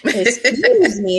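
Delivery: slow, drawn-out "Excuse me"; hammed-up offended retort; mock indignation. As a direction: stretch it out, playfully affronted.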